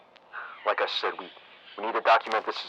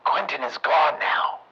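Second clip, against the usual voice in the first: ordinary talk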